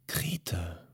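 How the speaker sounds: soft, voiceless wondering; musing whisper. A narrator's reading. Memetschik hears where the newcomers came from, dreamy whisper. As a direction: breathe the name as if far away. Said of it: "sagte Memetschik versonnen".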